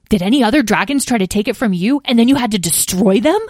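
put-on young dragon blurting out his question; excited kid butting in; eager interruption. A narrator's reading. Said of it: "interrupted Lyle".

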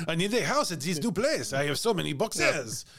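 French chef. Pretend. Voicing Jean-Baptiste.

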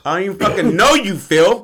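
loud, high, fast acted outburst; indignant shout. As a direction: act out an outraged denial at full volume. Acting out angry denial to acquaintance.

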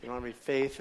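calm, disappointed correction, softly; mild rebuke. Acted. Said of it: "kinda rebukes them".

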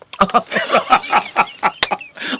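breathy real laughter, caught off guard. Real, not acted. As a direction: laugh helplessly, then try to stop. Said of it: "I shouldn't laugh".

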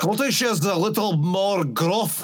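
gruff put-on Scottish accent. Shown as a demonstration. Co-host asked for a Scottish accent, demonstrates it. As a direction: lay on a thick Scottish brogue. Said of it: "Can you do a Scottish accent?".

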